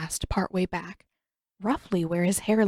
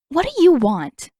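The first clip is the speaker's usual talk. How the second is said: higher, louder, snapping at him; angry demand; 8 semitones higher, 8 dB louder than usual. A narrator's reading. Katara snapping at her captor the prince, angry. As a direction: bite the question off hard.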